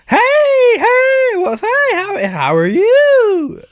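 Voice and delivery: high, loud, squealing fake-friendly hello; fake bubbly greeting. Mocking people who greet with fake cheer, hammed-up high squeal. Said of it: "When they see each other".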